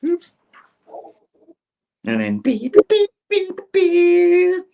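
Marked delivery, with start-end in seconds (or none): 2.4-4.6 very high loud beeping; beep sound effect. Retold or imitated. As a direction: imitate an electronic bleep. Imitating a bleep sound.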